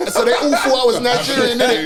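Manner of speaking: says it through laughter; amused, laughing as he tells it. Real, not acted. Laughing while telling friends about his Nigerian mix-up.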